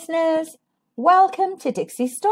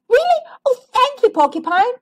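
much higher, squeaky delighted little squirrel; excited squirrel; 12 semitones higher, 3 dB louder than usual. Acted. Squirrel thanking porcupine for flour, high excited squeak. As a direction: squeal with grateful surprise.

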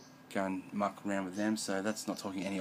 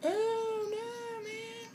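dismayed; much slower than usual. Real, not acted. Camera falling over.

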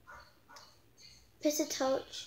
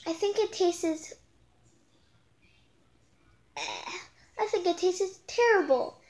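high complaining voice; 5 semitones higher than usual. Acted. Cassidy voiced in story.

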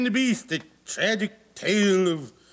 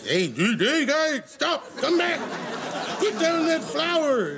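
dwarves; 8 semitones higher than usual. Retold or imitated. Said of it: "they're like".

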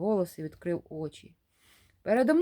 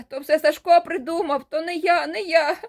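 high, loud frightened blurting; panicked excuses; 9 semitones higher, 8 dB louder than usual. Acted. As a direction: blame your friend in terror. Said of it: "пролепетав я".

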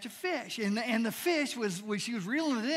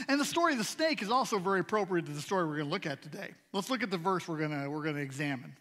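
ordinary talk, nothing marked

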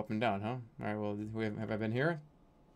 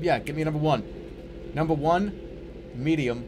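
calling out; 4 semitones higher, 5 dB louder than usual. Real, not acted. Calling out a McDonald's order.

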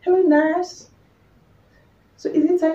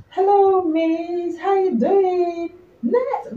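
sweet high greeting; 6 semitones higher, much slower than usual. Acted. Greeting pregnant women sweetly.

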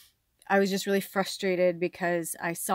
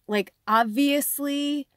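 drawn-out, higher-pitched emphasis on the obvious; exasperated; 4 semitones higher, 4 dB louder, slower than usual. Real, not acted. Venting about the landlord over the stuck car, exasperated. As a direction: spell it out slowly in disbelief.

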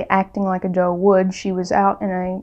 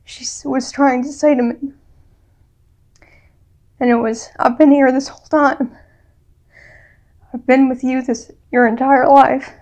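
tearing up; 4 semitones higher than usual. Real, not acted. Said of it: "I'm sorry, I'm gonna tear up".

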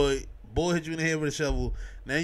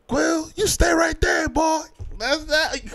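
much higher, slow mock shout; Yondu impression; 13 semitones higher, 3 dB louder, slower than usual. Imitating Yondu yelling at Quill, high mock shout. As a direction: bark it like a space pirate captain. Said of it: "Um, Yondu".

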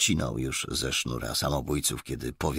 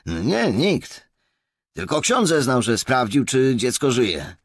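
high, loud policeman's voice; young constable reporting; 9 semitones higher, 7 dB louder than usual. A narrator's reading. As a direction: report eagerly to a superior, pitched well up. (wysoki, głośny głos posterunkowego)

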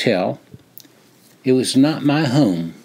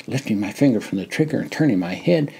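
plain reading voice

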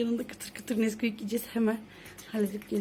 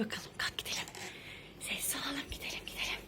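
whispering; 3 semitones higher, 9 dB quieter than usual. Real, not acted. Sneaking, asks for silence.

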